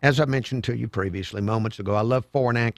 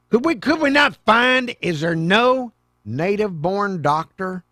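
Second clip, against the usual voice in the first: indignant outburst; 5 semitones higher, 4 dB louder than usual. Real, not acted. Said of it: "ranting about a foreign doctor".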